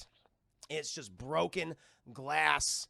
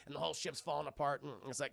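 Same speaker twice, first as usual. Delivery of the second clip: ordinary talk, nothing marked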